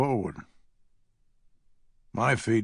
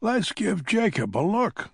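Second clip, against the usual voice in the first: put-on fussy old lady; 9 semitones higher than usual. A narrator's reading. Hazel's voice continues.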